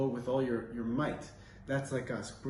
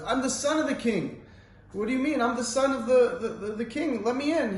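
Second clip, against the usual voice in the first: higher, urgent plea to be let in; pleading prince; 10 semitones higher, 4 dB louder than usual. Retold or imitated. Prince begging guards at the gate, higher urgent plea. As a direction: beg the guards, voice pitched up.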